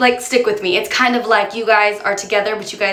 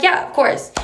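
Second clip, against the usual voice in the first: high mock agreement; 8 semitones higher than usual. Agreeing with a knowing irony.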